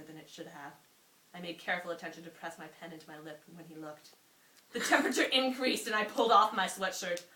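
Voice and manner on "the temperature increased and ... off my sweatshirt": louder, laughing through the words; giggling while reading the racy part. Real, not acted. Reading her racy old passage aloud, embarrassed laughter.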